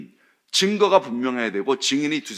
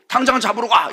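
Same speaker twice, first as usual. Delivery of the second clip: higher, louder barked order; 8 semitones higher, 5 dB louder than usual. Retold or imitated. Leaders ordering officers to arrest Jesus, loud barked order. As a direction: snap the order at them.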